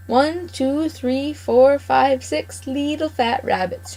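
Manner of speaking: put-on old gardener counting his catch with glee; gloating farmer counting. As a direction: savour each number like a greedy old farmer. Mr McGregor repeats the count.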